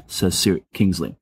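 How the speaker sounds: usual speaking voice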